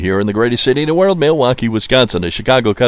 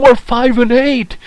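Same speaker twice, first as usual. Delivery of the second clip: high, slow mock fan voice, much higher than his own; mock Philly fan; 12 semitones higher, slower than usual. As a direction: ham up a smug Philadelphia fan. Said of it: "They're going".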